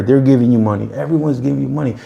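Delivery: normal talking voice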